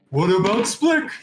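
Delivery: high, loud worried call; Wugtub's high, loud question. Acted. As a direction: shout the question after the fleeing wagon. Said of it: "you hear Wugtub say".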